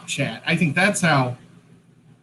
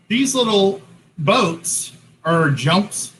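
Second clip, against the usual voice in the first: usual speaking voice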